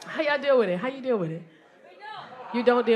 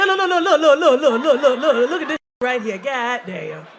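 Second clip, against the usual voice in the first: imitating an insistent guy. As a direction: impersonate a pushy guy grabbing attention. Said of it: "Every time you talk to him, his hand".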